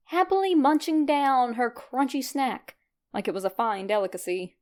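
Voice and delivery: usual speaking voice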